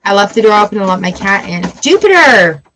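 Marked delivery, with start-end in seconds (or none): 1.8-2.6 loud call of Jupiter; calling the cat. Real, not acted. Owner calls her cat Jupiter away. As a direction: call out to the cat across the room.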